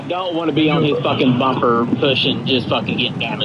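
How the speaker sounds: loud frustrated venting; shouting. Real, not acted. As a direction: yell it out in frustration. Angry driver venting over voice chat.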